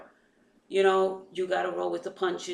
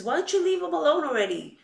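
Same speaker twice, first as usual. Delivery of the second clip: exasperated husband; 10 semitones higher, 7 dB louder, faster than usual. Retold or imitated. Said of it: "My husband says".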